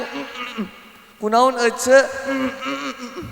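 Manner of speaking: mock closed-mouth "hmm" noises; stifled humming. Acting someone straining not to gossip, stifled hums. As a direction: hum as if holding words in. Imitates struggling to keep mouth shut.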